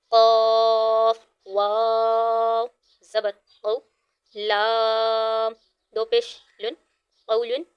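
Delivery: letters drawn out one by one for pupils to repeat; slow teacher's spelling chant; slow spelling drill. Shown as a demonstration. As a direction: spell it out slowly like a teacher at the board.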